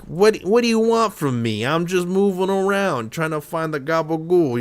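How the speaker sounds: high-pitched East Coast Italian wise guy; squirrely dog voice. Acted. Owner voicing her Italian greyhound, squirrely high voice. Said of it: "a very squirrely".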